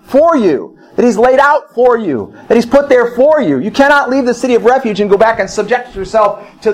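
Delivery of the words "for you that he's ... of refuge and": high, driving sermon voice; fervent preaching. Acted. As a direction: preach it to the congregation with fire. Preaching at full force.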